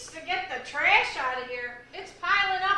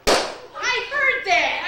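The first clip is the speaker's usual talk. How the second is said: excited; 4 semitones higher, 8 dB louder, much slower than usual. Acted. Deaf woman suddenly hears.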